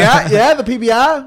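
high, loud Boston barman; thick Boston accent. Retold or imitated. Bartender confirming the customers' beer order, thick Boston accent. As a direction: blare it in broad Boston. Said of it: "had the thickest Boston accent in the world".

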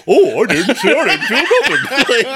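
high, loud Goofy-like doofus; mock her as a clueless cartoon goof; goofy high voice, breaking with laughs; dopey wife impression. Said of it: "your impression of her".

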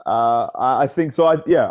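ordinary talk

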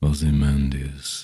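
deep slow narrator Ozymandias; dropped and slow. Shown as a demonstration. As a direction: drop the voice for a ponderous Ozymandias. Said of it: "I drop it to ... and do it slow".

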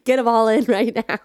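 loud jokey shout. Pretend. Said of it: "we didn't even do any accents".

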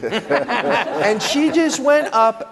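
laughing through the words, drawn out slow. Real, not acted. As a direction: laugh as you relive the moment. Laughing while telling the lift story.

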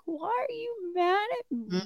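put-on high, soft little-girl voice; imitating a hurt child. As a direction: plead like a wounded small child. Imitating her sensitive daughters.

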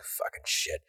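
barely voiced curse under the breath; whispered curse. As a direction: hiss it to yourself, eyes down. [name] grumbling, looking down.

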